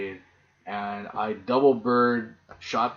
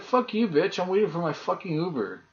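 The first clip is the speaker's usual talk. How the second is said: higher, re-enacted swearing at security; retold angry outburst; 8 semitones higher than usual. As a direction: fire it back at the guard like you mean it. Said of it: "I was like".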